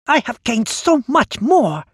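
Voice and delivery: high, loud furious monster; enraged. Acted. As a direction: spit it out in rage. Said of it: "enraged by my insults".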